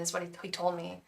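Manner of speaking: ordinary delivery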